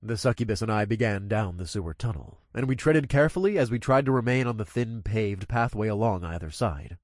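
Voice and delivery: usual speaking voice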